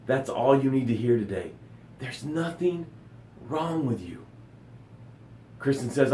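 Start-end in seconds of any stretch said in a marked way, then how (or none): 2.0-4.2 much softer, hushed reassurance. Real, not acted. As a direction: say it again, hushed. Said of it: "I'm gonna say it again".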